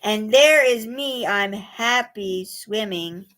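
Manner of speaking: slow, gentle reading; poem for children. A narrator's reading. Reading a pond poem to young children, slow and gentle. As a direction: savour each image slowly.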